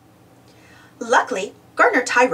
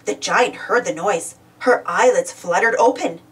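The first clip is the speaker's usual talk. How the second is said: ordinary delivery, nothing marked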